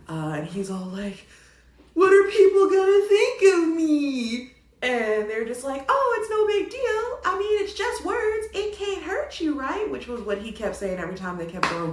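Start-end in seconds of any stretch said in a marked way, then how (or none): 1.9-4.5 loud, high, drawn-out mock wail; hammed-up tearful panic; freaking out. Exposed man fretting about people's opinion, mock freak-out. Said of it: "James Marsden freaking out". 5.9-9.9 high, singsong mock reassurance; mock breezy dismissal. Group answering the panicking man, mock dismissive.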